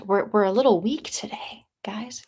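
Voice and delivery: hoarse-throat near whisper to the viewers; sore-throat whisper. Real, not acted. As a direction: whisper it, voice gone weak. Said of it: "my throat".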